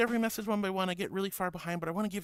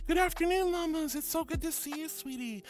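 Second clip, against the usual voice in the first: bright high greeting; 8 semitones higher than usual. Real, not acted. Greeting a viewer.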